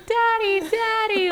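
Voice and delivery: high, loud mock child's wail; emotional little kid. Little kid running over to his dad, imitated emotional cry. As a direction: call out like an upset little child. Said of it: "being really emotional about it".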